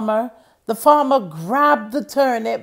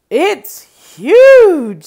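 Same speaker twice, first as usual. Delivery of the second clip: high, loud gasp of wonder; the wife astonished by its size; wife amazed; 8 semitones higher, 13 dB louder than usual. Acted. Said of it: "said his wife".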